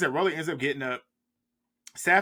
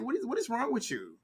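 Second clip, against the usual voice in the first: incredulous; 9 semitones higher, 3 dB quieter than usual. Retold or imitated. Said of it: "I'm like".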